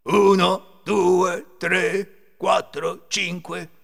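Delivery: loud, high, deliberate count; thief counting. A narrator's reading. Thief alone counting all the money, loud measured count. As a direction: count out each number loudly. Ladro conta da solo.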